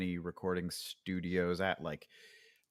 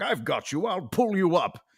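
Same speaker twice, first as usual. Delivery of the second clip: loud, raised heroic rescue voice; sexy strong hero; 10 semitones higher, 7 dB louder than usual. Shown as a demonstration. Hero hauling someone to safety, sexy strong hero. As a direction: shout it like a hero mid-rescue. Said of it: "and he's like".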